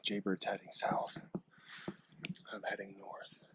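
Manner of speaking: hushed hunter whisper. Real, not acted. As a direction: whisper so the deer can't hear. Whispering while stalking deer.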